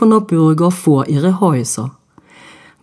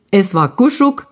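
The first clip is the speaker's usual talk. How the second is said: ordinary delivery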